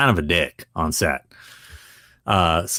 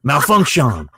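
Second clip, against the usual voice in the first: raised actor impression; imitated actor, higher-pitched; Robert Patrick; 6 semitones higher, 4 dB louder, slower than usual. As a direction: confirm the handle as the actor. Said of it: "And he goes".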